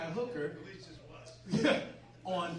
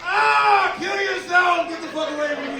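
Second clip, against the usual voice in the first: screaming in horror; horrified shout at the wife; panicked scream; 12 semitones higher, 16 dB louder than usual. Retold or imitated. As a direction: shriek Get the fuck away at the top of his lungs. Husband screams at zombie wife.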